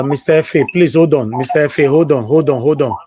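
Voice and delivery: loud repeated call to hold. Real, not acted. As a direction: call out loudly to a waiting caller. Host calling out to waiting caller.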